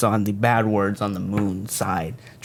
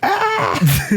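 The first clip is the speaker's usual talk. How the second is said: short high-pitched scream; sudden high yelp of outrage; mock scream. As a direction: let out a quick high scream. Mock outrage at co-host's joke.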